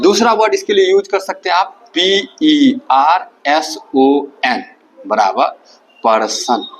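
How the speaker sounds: normal talking voice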